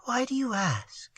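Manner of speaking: soft frightened whisper. A narrator's reading. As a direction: whisper it in fear. Frightened girl after trembling answer.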